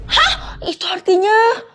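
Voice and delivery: high gasp, then loud, slow wail; shocked dismay. Acted. Girl learns of mother's promise, shocked dismay.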